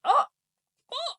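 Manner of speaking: high squeaky disbelief; a shocked, rising what; stunned. Retold or imitated. Stunned by grandpa's claim.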